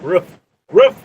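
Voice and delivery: roof barked short like a dog's woof. Pretend. Teasing Bruce about his roof, mock dog bark. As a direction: turn roof into a high little bark. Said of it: "That's what a dog does".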